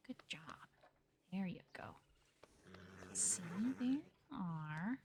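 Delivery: slow whispered praise to a horse; hushed calming whisper; soothing whisper. Real, not acted. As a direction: whisper gently to settle the animal.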